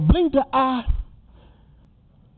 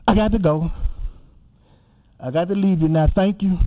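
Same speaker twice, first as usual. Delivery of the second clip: ordinary delivery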